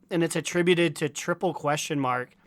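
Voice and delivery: ordinary talk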